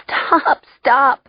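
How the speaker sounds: high, breathless plea; begging. A narrator's reading. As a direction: beg helplessly for it to stop. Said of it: "I begged".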